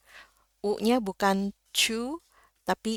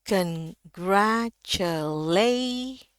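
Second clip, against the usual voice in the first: "congratulate" très lent, "late" tenu au plus haut; découper "congratulate" et monter sur "late"; ton culminant sur LAY; much slower than usual. Shown as a demonstration. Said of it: "lay paling tinggi nadanya".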